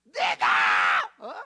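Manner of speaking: high, loud, crying accusation; a mother demanding her son's exam pass; tearful furious shout. Retold or imitated. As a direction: demand it through tears, voice cracking. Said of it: "내가 막 따지듯이 한 거죠".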